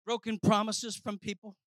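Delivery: ordinary talk, nothing marked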